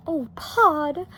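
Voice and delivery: high, mildly whimpering Homley; a touch of a frightened whimper. A narrator's reading. Homley pleads with her husband, whimpering. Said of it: "whimpered Homley".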